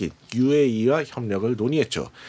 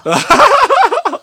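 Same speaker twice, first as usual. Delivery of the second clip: loud, high-pitched burst of laughing; burst of laughter; 11 dB louder than usual. Real, not acted. Co-host hears the dialect punchline, loud helpless laughter. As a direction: crack up loudly and high.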